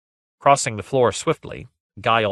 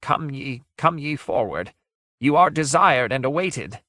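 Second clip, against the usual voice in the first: higher, quavering, as if just after weeping; soft quavering voice; 6 semitones higher than usual. A narrator's reading. Ghost beckons visitors into the dark, quavering voice. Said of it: "full of catches and quavers".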